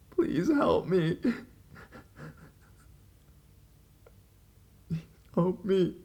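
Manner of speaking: high, slow, tearful begging; crying plea. Retold or imitated. Girl on the phone pleading with the caller, slow and tearful. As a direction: drag each word out through tears.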